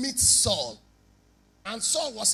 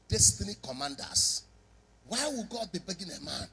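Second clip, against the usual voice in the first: normal talking voice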